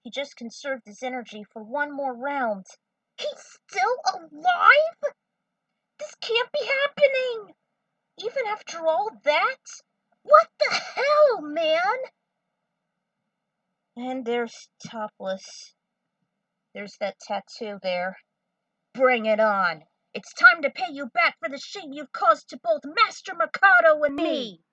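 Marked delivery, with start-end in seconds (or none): 3.2-12.0 high, loud disbelieving cries; shocked shout. Acted. Dragon Trio member shouting in shock at the survivor. Shocked character in fanfic reading. 18.9-24.6 defiant challenge. Acted. Character challenges foe in story.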